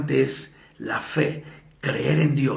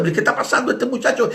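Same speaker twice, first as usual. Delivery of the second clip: baffled students; 8 semitones higher, faster than usual. Retold or imitated. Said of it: "los muchachos se sacaron de onda".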